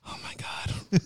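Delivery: breathy whispered disbelief; whispered exasperation at mom. Retold or imitated. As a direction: mutter it under your breath, unvoiced. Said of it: "I'm like".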